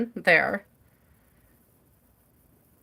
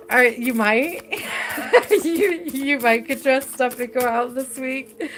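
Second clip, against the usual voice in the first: higher, giggling through the words; laughing; 4 semitones higher than usual. Real, not acted. Reader telling client to dress up, laughing. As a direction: try to advise while cracking up. Cracking up while repeating the suggestion.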